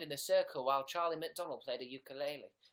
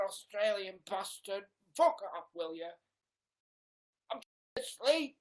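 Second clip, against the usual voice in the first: higher, louder angry shout through the door; 7 semitones higher, 7 dB louder than usual. Retold or imitated. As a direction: bark him away, furious. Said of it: "[name] opens the door and says".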